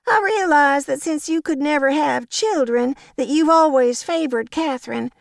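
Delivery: high, sugary fake-sweet old lady; Violet, syrupy gossip. A narrator's reading. Violet spreads gossip to Agnes with high sugary malice. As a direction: drip false sympathy, pitched high.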